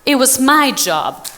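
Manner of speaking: "my" punched and slowed; "It was my job", slow, with "my" stressed. Real, not acted. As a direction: lean hard on "my" with a slow pace. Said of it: "It was not his job to believe in my dream".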